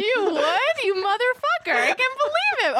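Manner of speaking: high, playful disbelief; mock outrage. Real, not acted. Mock outrage at friend's lie.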